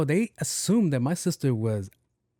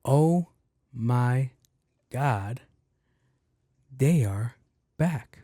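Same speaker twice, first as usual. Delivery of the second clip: slow, deliberate quote with gaps between words; deadpan, drawn-out repeat of her words; much slower than usual. Retold or imitated. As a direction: leave a big pause after OH, MY and GOD. Repeats lady's quote word by word.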